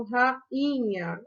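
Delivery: "rainha" slowly as ra-i-nha; split into syllables. Shown as a demonstration. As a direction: let the i in "rainha" stand alone. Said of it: "o i vai funcionar como uma sílaba sozinha".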